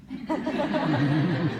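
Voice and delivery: warm audience laugh. Real, not acted. Pam laughing at her past self. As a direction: laugh at yourself.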